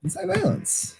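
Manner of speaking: high squeaky cartoon voice. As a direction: pitch it up into a squeak. Squeaky put-on voice again.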